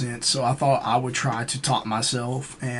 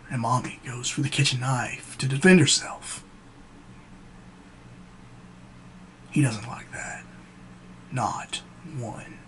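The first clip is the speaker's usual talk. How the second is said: menacing monologue, long pauses, trailing into near-whisper; Joker. Acted. Joker taunting Gamble with how he got his scars, menacing. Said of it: "This is when the Joker".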